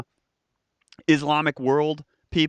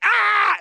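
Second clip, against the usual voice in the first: sudden high yelled "Ah!"; mock war cry; 6 dB louder than usual. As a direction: let out a wild battle yell. Imagined Glasgow man attacking.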